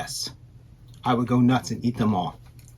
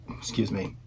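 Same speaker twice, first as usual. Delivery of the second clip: ordinary delivery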